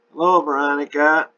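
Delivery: put-on high, loud greeting in an English accent; English-accented tenor, pitched well up. A narrator's reading. Tenor friend greets the arriving woman, English accent. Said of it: "the tenor voice said in a definite English accent".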